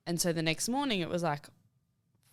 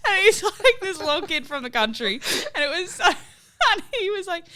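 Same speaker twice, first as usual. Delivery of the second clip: talking high and loud through laughter; cracking up while telling it; laughing; 7 dB louder than usual. Real, not acted. Laughing at her own story.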